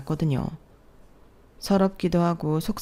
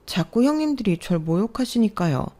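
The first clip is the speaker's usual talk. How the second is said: ordinary talk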